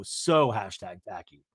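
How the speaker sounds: low, slow deadpan parody; mock catchphrase. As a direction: drop the pitch and drag it out. Mimics the hated catchphrase drily.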